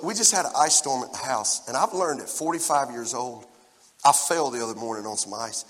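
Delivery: normal talking voice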